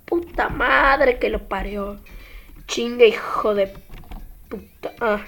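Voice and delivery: low muttered swearing; cursing under breath. Real, not acted. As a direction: curse softly to yourself. Cursing quietly after fail.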